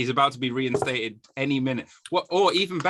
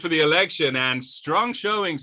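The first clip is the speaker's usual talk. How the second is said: higher, louder mock election-night anchor voice; mock news anchor; 5 semitones higher, 4 dB louder than usual. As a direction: announce the results like a breaking news bulletin.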